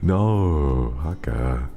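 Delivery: high, mock-offended scold of pet; playful scolding. Real, not acted. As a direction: tell the pet off, half laughing. Scolding pet over chat story.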